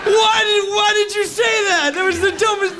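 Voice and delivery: frantic inner shout at himself; panicked inner yell. Retold or imitated. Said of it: "So in my mind, I'm like".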